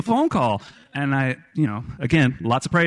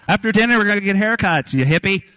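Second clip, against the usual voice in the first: higher, louder call across a crowded room; teasing shout; 8 semitones higher, 4 dB louder than usual. Retold or imitated. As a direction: razz him loudly from across the restaurant. Uncle teasing him loudly.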